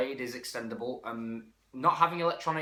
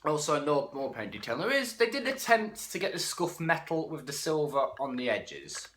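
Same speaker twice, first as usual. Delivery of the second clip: usual speaking voice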